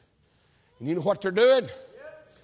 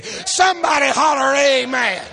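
shouted call for an amen, higher and louder; preacher's shout; 4 semitones higher, 7 dB louder, slower than usual. Real, not acted. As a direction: rouse the congregation to shout back. Preacher calls for congregation response.

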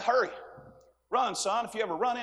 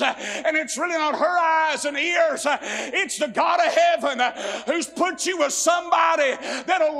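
fiery preaching; 6 semitones higher, slower than usual. Real, not acted. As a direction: preach it out full force. Preaching at full force.

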